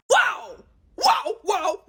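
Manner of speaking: high shocked whoas tapering off; startled whoa. Real, not acted. Reacting to harsh dad.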